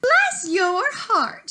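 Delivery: put-on high, slow, quavering old lady voice; a frail granny calling through the door; Grandmother. Grandmother answering the knock, high and slow. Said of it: "said Grandmother".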